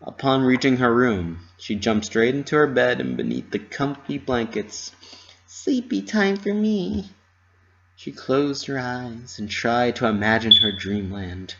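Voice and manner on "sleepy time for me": sleepy. A narrator's reading. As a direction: coo it while snuggling in. Pinky snuggles into bed.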